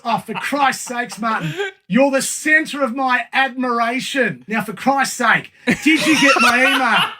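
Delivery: put-on impatient dad in English accent, laughing; imitating dad's English accent while laughing. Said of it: "And Dad, English accent".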